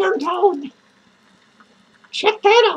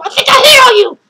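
mock outburst; 6 semitones higher, 8 dB louder, faster than usual. Sudden outburst after quiet line.

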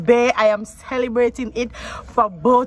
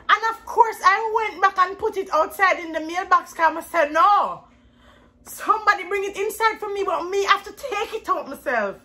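excited; 10 semitones higher than usual. Real, not acted. Excited retelling.

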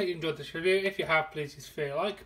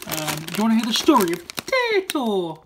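sing-song Irish voice, pitched way up; put-on Irish accent; 4 semitones higher, 7 dB louder than usual. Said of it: "it came out slightly like this 'cause it's Irish related".

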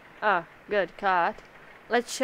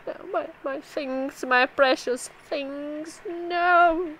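hammed-up higher whimper, slow in the middle; mock whiny wail; 6 semitones higher, slower than usual. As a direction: whine like a spoiled child losing toys. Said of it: "She's like".

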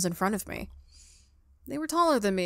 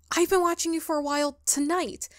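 flustered correction; 7 semitones higher than usual. Acted. Ghost corrects creepy phrasing.

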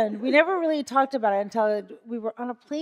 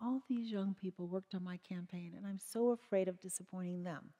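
much softer, hushed confession; hushed, afraid of letting people down; soft, worried; 14 dB quieter than usual. Retold or imitated. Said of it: "what Barack said is, like".

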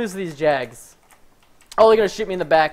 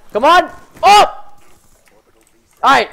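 high, loud urging; hyped shout; 9 semitones higher, 9 dB louder, slower than usual. Real, not acted. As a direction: shout it, pumped for the streak. Hyped on kill streak.

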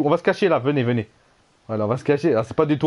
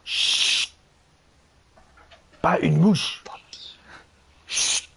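whispering while hiding; 3 semitones higher, much slower than usual. Real, not acted. Hiding, telling team to hush.

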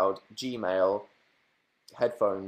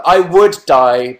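dramatic wail; 6 semitones higher, 12 dB louder, much slower than usual. Acted. Dramatic answer to own question.

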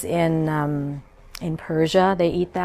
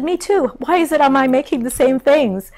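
amused surprise; 8 semitones higher, 4 dB louder than usual. Retold or imitated. Amazed reply to mother.

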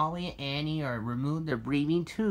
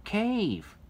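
excited; 5 semitones higher, 5 dB louder than usual. A narrator's reading. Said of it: "said Ricky excitedly".

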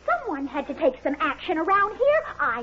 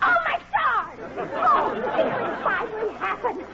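panicked shout; 7 semitones higher than usual. Acted. Secretary hears her boss snapped, panicked shout. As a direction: cry out in sudden panic. Bernadette panics about Madam.

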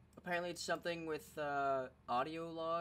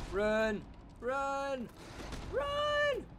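panicked shouting, much higher; being chased; 12 semitones higher, 9 dB louder, much slower than usual. Real, not acted. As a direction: yell it while fleeing for your life. Fleeing deathclaws in game.

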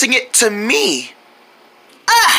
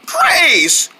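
ordinary talk

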